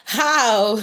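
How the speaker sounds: laughing through the word; real laughing. Real, not acted. Healer amused by a viewer's church story, laughing. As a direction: break into a chuckle on it. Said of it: "I'm laughing".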